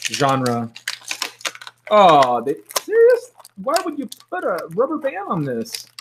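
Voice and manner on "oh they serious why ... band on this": very high, incredulous squeal; exasperated disbelief. Real, not acted. Collector finding a rubber band on the figure, high-pitched disbelief.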